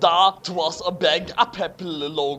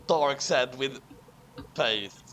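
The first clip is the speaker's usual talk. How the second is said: normal talking voice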